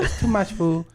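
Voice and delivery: put-on a sushi chef's Japanese accent; mock Japanese accent. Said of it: "Just do the accent".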